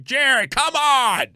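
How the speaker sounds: high, slow call; grandmother calling. Retold or imitated. Grandmother calling her grandson to leave, high and slow. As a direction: call him like a tired grandma. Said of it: "she was like".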